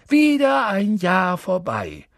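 high, loud, drawn-out proclamation; exuberant calling out. A narrator's reading. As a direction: call merrily across a full hall. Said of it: "rief Dumbledore ausgelassen".

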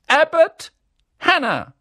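high, loud call across the hall; calling out name. A narrator's reading. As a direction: announce the pupil to the whole room. Calls out names. (Professorin ruft laut den Namen auf)

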